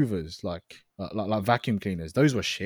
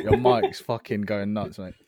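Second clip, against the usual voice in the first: ordinary talk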